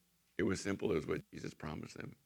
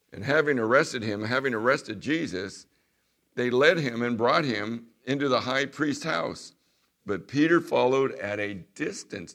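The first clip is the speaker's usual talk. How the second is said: scripture reading; 4 semitones higher, 10 dB louder than usual. Acted. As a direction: proclaim the verses to the congregation. Reading scripture aloud to congregation.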